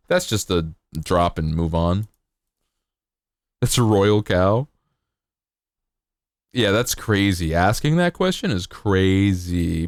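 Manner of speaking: ordinary talk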